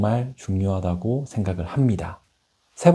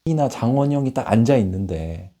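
usual speaking voice

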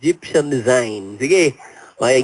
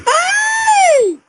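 very high squealed yelp; mock pain scream; 4 dB louder than usual. Host plays medium under the whip, mock scream. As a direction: scream as if whipped, hammed up. Acted medium being whipped.

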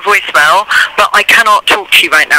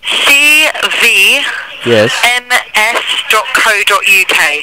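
slow spelling; much slower than usual. Real, not acted. Spelling address letter by letter.

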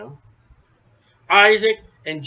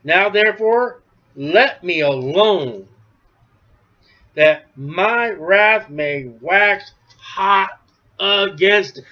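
slow solemn reading; 3 dB louder, slower than usual. Acted. Reading God's angry words.

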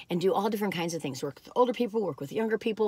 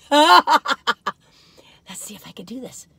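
laughing whisper; 2 semitones higher, 7 dB louder, much slower than usual. Real, not acted. Trying to tape the earring.

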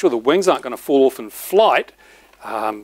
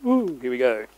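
ordinary talk

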